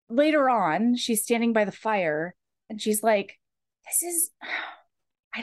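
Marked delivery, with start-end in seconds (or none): 3.9-5.4 sigh, then whisper it to yourself; a puzzled whisper, half to herself. Acted. Heroine musing by the fire.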